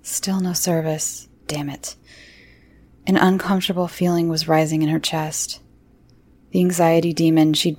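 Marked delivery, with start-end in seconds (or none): 1.5-2.0 soft frustrated whisper; whispered curse. A narrator's reading. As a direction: hiss it under your breath.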